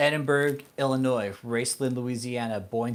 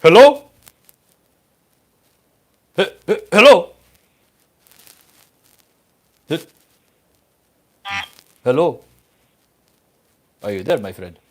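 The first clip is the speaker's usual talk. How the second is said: loud, high hello into the phone; calling out; 6 semitones higher, 14 dB louder than usual. Real, not acted. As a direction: shout to check if anyone's there. Calling into dead scam line.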